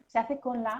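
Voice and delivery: ordinary talk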